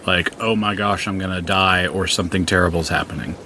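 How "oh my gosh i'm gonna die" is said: ordinary talk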